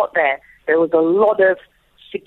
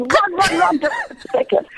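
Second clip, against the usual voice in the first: high, urgent mock yell; retold scream; 10 semitones higher than usual. Mother sending her son across the field, imitated screaming. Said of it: "I'm screaming at my son".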